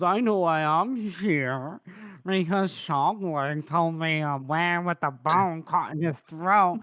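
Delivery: slow, quacky cartoon duck voice; lightly quacking cartoon duck delivery; Donald Duck voice. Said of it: "You sound like Donald Duck".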